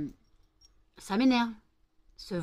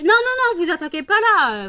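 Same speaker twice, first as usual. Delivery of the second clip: high shriek, much louder, telling them off; yelling at dogs; 10 semitones higher, 12 dB louder than usual. Real, not acted. Owner shrieking at dogs jumping on the phone, high and much louder.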